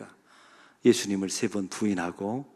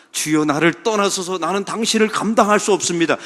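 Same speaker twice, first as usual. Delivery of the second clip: loud confession; 7 semitones higher, 7 dB louder than usual. Retold or imitated. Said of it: "이렇게 고백합니다".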